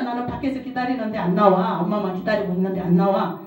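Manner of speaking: ordinary delivery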